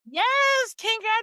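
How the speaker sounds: high, loud happy cheer; excited cheer. Real, not acted. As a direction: cheer with delight. Cheering for Paris.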